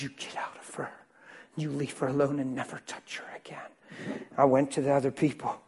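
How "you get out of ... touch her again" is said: intense soft whisper, commanding; whispered rebuke. Retold or imitated. Pastor whispering a command at the sickness.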